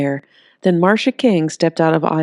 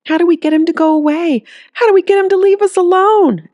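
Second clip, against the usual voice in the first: much higher, louder frantic plea; panicked pleading; 14 semitones higher, 6 dB louder than usual. Acted. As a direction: beg like the monsters are at the door. Terrified mother pleads.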